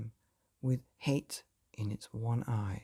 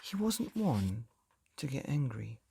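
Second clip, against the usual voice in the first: ordinary delivery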